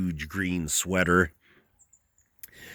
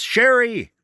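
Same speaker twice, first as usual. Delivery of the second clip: loud, much higher call of a name; 14 semitones higher, 6 dB louder than usual. A narrator's reading. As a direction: yell toward the trailer for the wife. Calling wife inside trailer.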